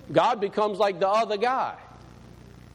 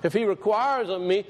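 ordinary talk

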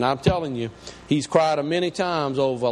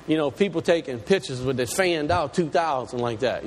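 normal talking voice

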